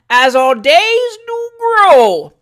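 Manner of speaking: "days" and "grow" bellowed high and drawn out; over-the-top booming recitation; loud, emphatic. A narrator's reading. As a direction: declaim it hugely, very slow and high, leaning on "days". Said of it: "then loud again".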